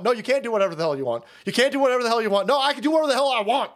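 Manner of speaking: fast back-and-forth mock quarrel; playing cop and driver in a fast argument; rapid mock argument. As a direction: rattle off both sides quickly. Acts out cop and driver arguing.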